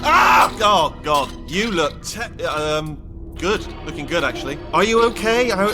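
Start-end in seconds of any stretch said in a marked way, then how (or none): 0.0-0.6 high, sudden cry of shock; startled yelp. Acted. Robot first seeing the test subject, startled yelp. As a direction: yelp at an alarming sight.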